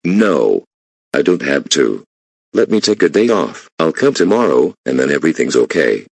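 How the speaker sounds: loud defiant pleading; loud refusal. Acted. Employee refusing the boss's order, loud and defiant. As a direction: dig in and refuse, loudly.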